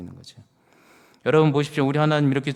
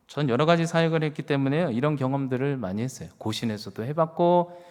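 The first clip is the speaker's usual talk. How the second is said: normal talking voice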